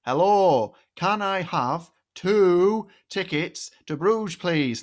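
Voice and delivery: over-careful tourist request; hammed-up Englishman asking for tickets abroad; mock polite tourist. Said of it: "I was like".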